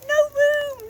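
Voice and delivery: very high, loud frantic shriek; shrieking Mad Hatter turning a guest away; Hatter shouting. A narrator's reading. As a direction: yell it like a fussy madman shooing guests. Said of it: "the Hatter shouted".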